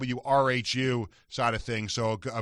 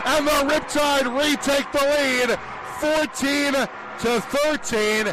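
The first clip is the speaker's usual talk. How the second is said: play-by-play call; 12 semitones higher, 9 dB louder, slower than usual. Acted. Radio play-by-play goal call.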